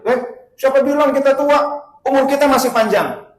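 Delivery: loud, high-pitched protest; indignant retort. Acted. Lover rejecting woman's plea to stop sinning, indignant. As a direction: fire back loudly, voice jumping up.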